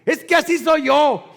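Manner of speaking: loud, high rude retort; defiant driver. Retold or imitated. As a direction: snap back like the rude driver. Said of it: "Y dice".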